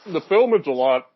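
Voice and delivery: talking through a bite; chewing while talking. Real, not acted. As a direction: mouth full of food. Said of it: "sorry, I'm chewing my food".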